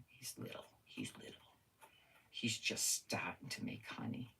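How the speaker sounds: soft whisper about a tiny bee. Pretend. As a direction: whisper it like a secret.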